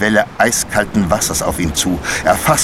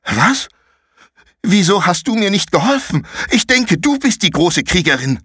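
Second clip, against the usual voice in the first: put-on much higher, heated boy's retort; angry comeback; 11 semitones higher than usual. Konrad angrily snaps back at Paula. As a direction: throw the blame right back. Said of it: "von seiner Wut selbst überrascht".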